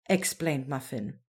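ordinary delivery, nothing marked

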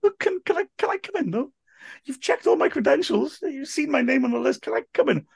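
very high-pitched, stammering plea; mock desperate pleading. Commentator begging receptionist for entry, high mock desperation. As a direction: plead in a squeaky, put-on high voice.

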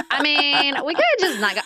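high-pitched imitation of a reluctant husband; husband's cancel voice. Husband talking wife out of going, wheedling imitation. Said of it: "It sounds just like my husband".

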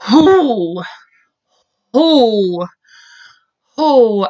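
real whoosh of disbelief; shaken "whoo" exhale. Real, not acted. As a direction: let out a heavy, overwhelmed whoo. Shaken exhale after murder news.

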